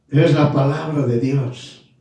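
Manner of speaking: ordinary talk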